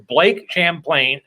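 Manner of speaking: "Champlain" said with a full "plain" ending; deliberate mispronunciation. Shown as a demonstration. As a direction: stretch "Champlain" to rhyme with plane. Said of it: "I always mess his name up on purpose".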